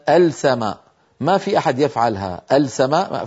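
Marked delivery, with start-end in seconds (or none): 0.1-0.8 السماء with the L wrongly sounded, "al-samaa"; mispronounced lam. Shown as a demonstration. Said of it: "فلا نقول أبدًا". 2.5-3.3 السماء as "al-samaa", L left audible; faulty unassimilated lam. Shown as a demonstration. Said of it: "السماء فاسدة ولا تصح أبدًا".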